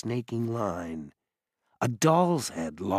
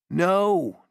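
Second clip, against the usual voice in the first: a higher, louder call up the shaft; 11 semitones higher, 7 dB louder than usual. A narrator's reading. Jared calling down from inside the dumbwaiter, higher and louder. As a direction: call it down to them from inside the wall. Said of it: "Jared called".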